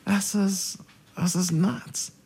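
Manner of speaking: high, slow disbelief; high, slowed-down disbelief at the news; incredulous. Retold or imitated. Reacting to getting the friend's job.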